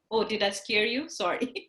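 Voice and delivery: ordinary talk, nothing marked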